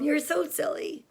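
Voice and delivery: usual speaking voice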